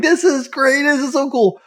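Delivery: high, bright gushing delight; excited. Retold or imitated. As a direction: gush like a thrilled fan. Excited quote of own reaction.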